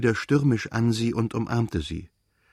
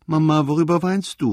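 put-on higher, louder, whining child's call; tearful cry; 6 semitones higher, 5 dB louder than usual. A narrator's reading. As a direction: call out plaintively like an upset child. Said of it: "rief er mit weinerlicher Stimme". (weinerlich gerufen, hoch und laut)

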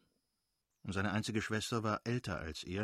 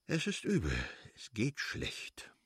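sighing lament; 2 semitones higher than usual. A narrator's reading. Stepan Arkadjitsch complains of his troubles, sighing deeply. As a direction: lament it, slowing right down as it ends. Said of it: "und seufzte tief".

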